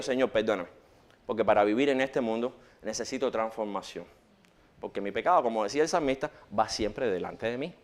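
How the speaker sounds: hushed, humble plea to the Lord; quiet prayer. Retold or imitated. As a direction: drop low and pray it softly. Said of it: "y digo".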